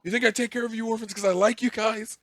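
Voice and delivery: high, loud put-on outburst of a fed-up chief; mock exasperated rant. Village chief to his orphans, mock exasperated and loud. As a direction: play the overburdened leader snapping at dependents.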